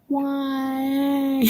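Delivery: high, soft, drawn-out wail; mock whine. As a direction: plead it in exasperation, pitched high. Acts out exasperation at loud TV.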